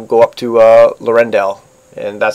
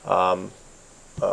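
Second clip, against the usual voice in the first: normal talking voice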